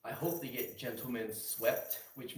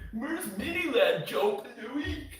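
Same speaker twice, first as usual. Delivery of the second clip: much higher, loud, slow whiny asking; mocking a viewer; 10 semitones higher, 6 dB louder, slower than usual. Streamer mimics a fan pestering him about Mini Lad, high and whiny. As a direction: whine the question like an annoying fan. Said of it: "He's like".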